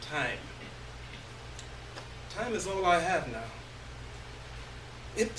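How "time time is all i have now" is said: ordinary delivery, nothing marked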